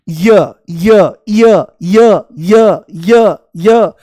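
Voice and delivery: य repeated in quick loud bursts like a stuck needle; loud stuttering य demo; stuttered य. Shown as a demonstration. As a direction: repeat य stuck like a skipping record. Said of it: "जैसे सीढ़ी अटक गई हो".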